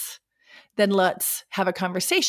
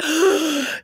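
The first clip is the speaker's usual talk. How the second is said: gasp; 7 semitones higher than usual. Retold or imitated. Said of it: "I was like".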